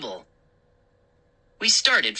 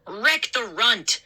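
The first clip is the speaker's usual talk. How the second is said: playful cry; 6 semitones higher, slower than usual. A narrator's reading. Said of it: "I cried".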